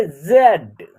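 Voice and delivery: "जेड" with its vowel held long; pronunciation demo. Shown as a demonstration. Teacher showing how the letter is pronounced, "जेड" drawn out. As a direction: draw out "जेड" on a falling tone. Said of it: "इसको उच्चारण करेंगे".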